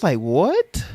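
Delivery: disbelieving what; shocked what. Retold or imitated. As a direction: sound floored by the comment. Shocked reaction to comment.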